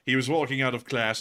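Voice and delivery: ordinary delivery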